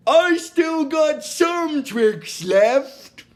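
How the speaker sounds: slow, high hammed-up hero boast; mock heroic Fry, slow and high. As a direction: drag out the boast, half laughing. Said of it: "he's like".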